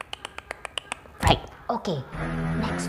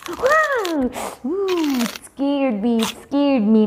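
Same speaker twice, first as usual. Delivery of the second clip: mock fright; 7 semitones higher than usual. Shark snapping at teacher.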